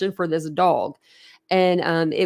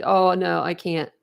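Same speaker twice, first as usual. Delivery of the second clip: ordinary talk, nothing marked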